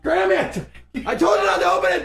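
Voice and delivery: fast, loud angry yell; angry shout. Real, not acted. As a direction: yell at him for opening it. Yelling at Graham over smoke.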